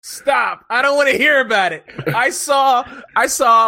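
loud protest. Real, not acted. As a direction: cut them off as if the movie pains you. Protests hearing about a movie.

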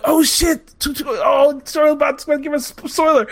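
mock-thrilled crowd, high and stumbling; excited players. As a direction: imitate fans tripping over their excitement.

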